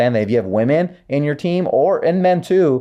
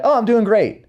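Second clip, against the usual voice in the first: much higher, breezy mock reply; breezy brush-off; 11 semitones higher than usual. Team member answering a leader's check-in, breezy brush-off. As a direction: imitate an automatic everything's-fine answer. Voicing the automatic upbeat reply.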